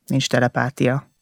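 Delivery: ordinary delivery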